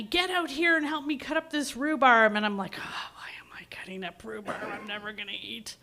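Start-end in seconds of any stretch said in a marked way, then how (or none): 0.0-2.3 raised, higher voice imitating a yelling mom; mock yell from another room; retold yelling. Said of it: "she's yelling at me". 2.8-5.8 much softer, half-whispered complaint; imitating a sulky teen's muttered protest; mock grumbling. Acted grumbling reply to yelling mother.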